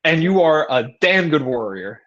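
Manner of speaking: imitating a hyped-up friend; pep talk. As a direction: build the buddy up, coach-style. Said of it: "this really long pep talk".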